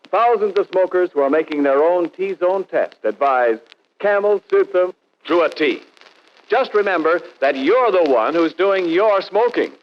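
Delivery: ordinary delivery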